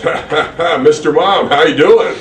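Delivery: high, loud, laughing boss voice; big hearty Vince laugh into the greeting; Vince McMahon impression. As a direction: cackle it out like a booming, jovial tycoon. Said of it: "Who does such a good Vince impersonation".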